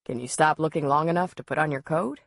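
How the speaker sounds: usual speaking voice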